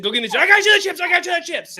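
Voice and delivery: high, loud mock-excited mom; high-pitched imitated mom; mom imitation. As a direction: squeal it like an overexcited mother. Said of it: "That's what my mom was like".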